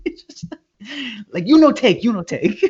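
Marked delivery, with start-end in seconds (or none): 1.5-2.6 high-pitched mock owner in broken English; imitated protesting owner. Restaurant owner stopping man grabbing money, high mock protest. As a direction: protest in a high, agitated put-on voice.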